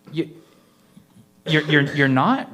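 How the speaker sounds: slow halting stammer; stammering fear. A narrator's reading. Rhonda stunned the man isn't the witch, stammering. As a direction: stumble over the words, stunned. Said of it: "Rhonda replied".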